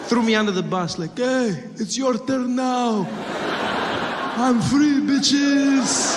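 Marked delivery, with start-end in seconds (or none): 1.2-6.2 high, drawn-out triumphant cry; hammed-up jubilant taunt, pitched high; gloating liberation. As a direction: crow it slowly in giddy triumph. Acted gloating by escaping man.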